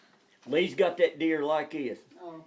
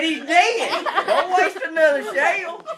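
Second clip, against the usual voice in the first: laughing shout; 11 semitones higher, 8 dB louder than usual. Retold or imitated. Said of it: "I said".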